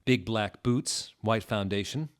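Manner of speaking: ordinary talk, nothing marked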